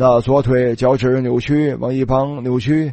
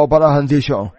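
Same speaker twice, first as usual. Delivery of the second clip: lightly voiced calling out; mild retold call. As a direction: imitate a caller, without really shouting. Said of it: "我听见有人叫喊说".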